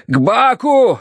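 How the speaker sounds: high, urgent yelled direction; yelling. A narrator's reading. Narrator directs the boat to the drowning pair, yelling. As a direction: yell out a frantic order. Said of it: "завопил я".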